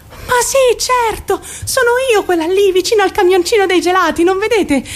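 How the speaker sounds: thrilled to spot herself in the photo; excited recognition. Acted. (entusiasta e acuta)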